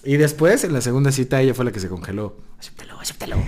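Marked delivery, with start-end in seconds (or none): 2.6-3.3 hushed, urgent whispering; retold whisper. Boyfriend retelling the second date's frozen moment, whispered. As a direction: whisper it like a secret plea. Voicing an urgent inner plea.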